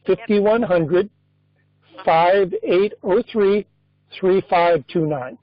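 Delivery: slow, careful read-back of a card number; reading digits back. Real, not acted. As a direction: read each group back slowly for checking. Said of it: "I'm gonna read that back to you".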